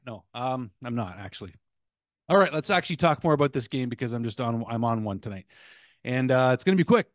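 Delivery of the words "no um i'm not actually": quiet. Real, not acted. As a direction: drop it back down quietly. Quiet retraction after outburst.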